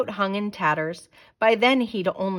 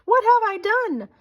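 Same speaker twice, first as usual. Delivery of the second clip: much higher, louder gasp of shock; horrified gasp; 13 semitones higher, 5 dB louder than usual. A narrator's reading. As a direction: gasp it in sudden horror. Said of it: "then gasped".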